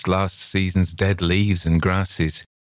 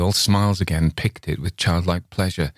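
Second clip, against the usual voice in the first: ordinary delivery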